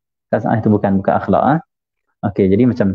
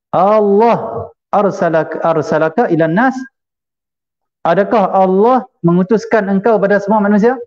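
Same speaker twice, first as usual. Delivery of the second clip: high, slow declaimed question; imitating a weighty, demanding question; solemn challenge; 8 semitones higher, slower than usual. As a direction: stretch it out like a grand interrogation. Declaiming the man's question to Prophet.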